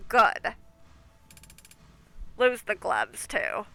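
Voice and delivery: nasal gatekeeper voice. Acted. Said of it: "plugging my nose when I do his voice".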